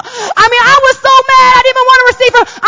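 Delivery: fired up recalling the insult; worked up about the skirt comment; reliving anger. Retold or imitated. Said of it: "I was angry".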